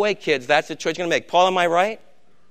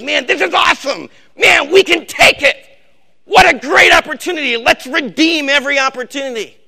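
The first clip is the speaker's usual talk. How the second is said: much higher, loud, thrilled shout; excited Paul; 9 semitones higher, 8 dB louder than usual. Pretend. Paul cheers the church on, loud thrilled shout. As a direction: roar it like a fired-up fan. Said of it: "The Apostle Paul says".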